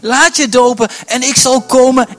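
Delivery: the Holy Spirit calling loudly to come. Acted. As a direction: call them forward with full force. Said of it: "die zegt".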